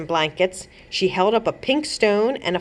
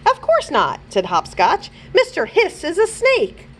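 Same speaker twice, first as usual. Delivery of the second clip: higher, prim hero voice; higher, matter-of-fact correction; Hopscotch voice; 10 semitones higher than usual. A narrator's reading. Hopscotch corrects the Goat Avenger, higher voiced.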